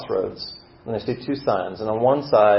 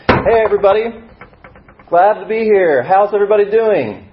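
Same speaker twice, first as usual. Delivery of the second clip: loud, high, over-eager greeting; so excited; 10 semitones higher, 7 dB louder than usual. Acted. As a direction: hail the whole room with excited, raised voice. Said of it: "you're so excited".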